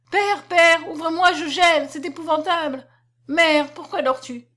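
high, loud desperate begging; frantic plea through a locked door; pleading cry. Acted. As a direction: beg your father through the door, freezing.

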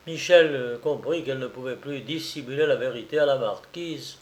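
ordinary delivery, nothing marked

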